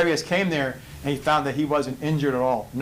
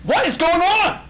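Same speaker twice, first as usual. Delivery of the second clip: very high and louder, climbing in disbelief; astonished king; 3 dB louder than usual. Acted. Nebuchadnezzar hears praise from the furnace, astonished high cry. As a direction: shriek it out like you can't believe the song you hear.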